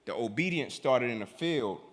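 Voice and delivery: ordinary delivery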